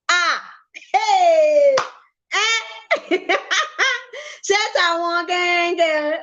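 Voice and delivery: high, laughing squeals of pure joy; overjoyed squeals. Real, not acted. Told the camera is his, he squeals with joy.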